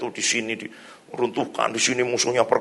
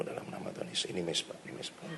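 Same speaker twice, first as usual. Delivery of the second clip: hushed, near-whispered declaration; murmured prayer; 8 semitones lower, 17 dB quieter than usual. Retold or imitated. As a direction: pray it under your breath, fading away. Menirukan doa pelan saat berjalan. (doa lirih nyaris berbisik)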